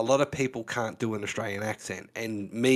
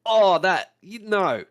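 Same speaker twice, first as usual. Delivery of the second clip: imitating Americans faking an Australian accent, much higher and louder; hammed-up cringey fake Australian accent, pitched way up; mock bad Australian accent; 9 semitones higher, 9 dB louder, slower than usual. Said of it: "hearing American actors do an Australian accent".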